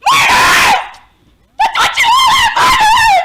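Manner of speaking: shrieking very high and loud, frantic; hysterical screaming. Acted. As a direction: scream for your stolen baby.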